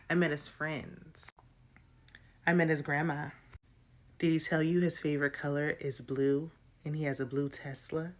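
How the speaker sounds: low, quiet, measured one-upping; understated, smug rival imitation; mock needling. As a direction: list your claims softly and pointedly, keeping it low. Acting out Lydia's needling one-upmanship.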